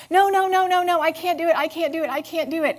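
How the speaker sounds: put-on high, loud whining of a child in a tantrum; toddler tantrum. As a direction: wail like a three-year-old refusing. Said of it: "a three-year-old having a total tantrum".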